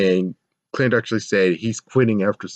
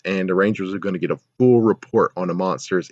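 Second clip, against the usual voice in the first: usual speaking voice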